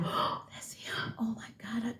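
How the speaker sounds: panicked whisper to herself; hushed, breathy panic at the ringing phone; retold whisper. Whispering to herself as her partner calls. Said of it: "I said".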